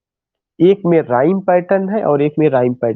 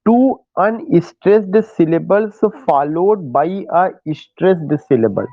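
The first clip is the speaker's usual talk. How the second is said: slow dictation; much slower than usual. Shown as a demonstration. Teacher dictates definition.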